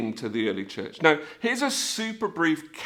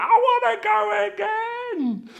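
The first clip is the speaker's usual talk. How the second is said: very high, slow, stretched-out squeal; hammed-up eager cry to ride more; acted elation; 4 dB louder, slower than usual. Rider turning to friend Billy, acted elation.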